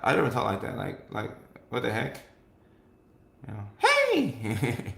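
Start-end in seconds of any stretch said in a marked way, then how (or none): 3.8-4.9 bright raised service greeting; mock cheerful hello; customer service voice. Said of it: "customer service mode".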